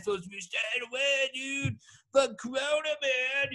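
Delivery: mock voice. Mock voice on staying home.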